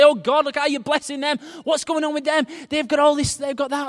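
high, loud mock protest to God; envious complaint.

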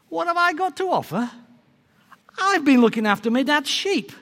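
high, affronted acted reply; indignant comeback. David answers the doubting king, indignant comeback.

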